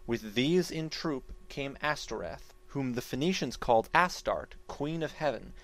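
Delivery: ordinary delivery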